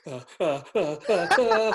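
tongue-tied fanboy grunts building in panic; starstruck wordless stammer. Retold or imitated. Starstruck fan lost for words.

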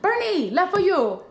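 high, excited imitation of salon worker; nail technician. Nail technician greeting returning client, excited imitation. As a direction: call it out high, drawing out the news.

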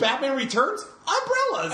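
playful exclamation. Pretend. Listing umbrella movies playfully.

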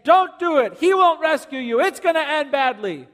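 a mock fearful inner voice. Shown as a demonstration. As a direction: shout it like panic talking you out. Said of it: "you will hear the voice of fear saying".

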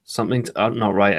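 slowed, halting dawning doubt; hesitant, drawn-out second thoughts; slow realization. Retold or imitated. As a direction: let the doubt creep in slowly. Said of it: "that moment of".

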